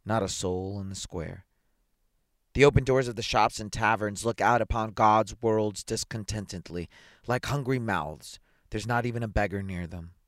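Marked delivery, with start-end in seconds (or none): none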